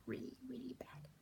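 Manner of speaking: embarrassed whispered confession; whispered aside. Real, not acted. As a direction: drop to a sheepish whisper. Self-mocking aside on accents.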